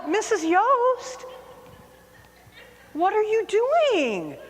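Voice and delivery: high, slow mocking call; teasing sing-song. Mock-innocent students calling after her. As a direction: students taunting the teacher who pranked back.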